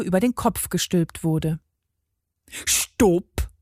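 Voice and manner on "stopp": mild, contained yell; sudden halt command, altered voice; bellowed stop. A narrator's reading. God halts the hairdryer, mildly bellowed. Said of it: "brüllte der Göttliche".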